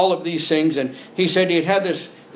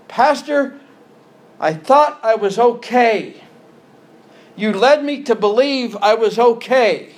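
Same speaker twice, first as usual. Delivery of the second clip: higher, louder anguished plea; pleading church member; 6 semitones higher, 4 dB louder than usual. Acted. Lost church member accusing his pastor, anguished and loud. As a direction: cry out to the pastor in dismay.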